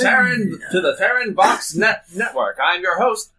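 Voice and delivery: put-on grand old ballroom announcer; old-timey announcer. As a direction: boom it like vintage radio host. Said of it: "Go to the old-timey announcer".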